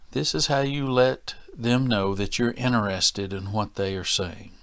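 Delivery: ordinary talk, nothing marked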